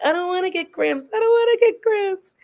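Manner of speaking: put-on high, half-comic crying child voice; imitating a crying child. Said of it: "But she was like".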